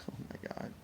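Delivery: whispered in exasperation; very soft, voiceless sigh of disbelief; overwhelmed. Real, not acted. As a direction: breathe it out under your breath. Overwhelmed by the confusing paperwork.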